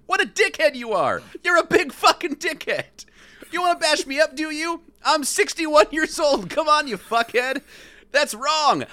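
high-pitched tirade of rage; acted screaming rant. A narrator's reading. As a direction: rant at the top of the register. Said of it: "he screams".

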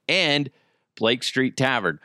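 normal talking voice

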